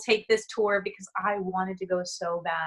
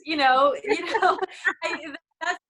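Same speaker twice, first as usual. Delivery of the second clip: higher, loud, breaking into a laugh; real laughter through the words; 7 semitones higher, 4 dB louder than usual. Real, not acted. Friend urging skydiving before one dies, laughing.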